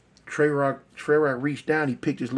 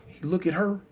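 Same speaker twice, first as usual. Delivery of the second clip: usual speaking voice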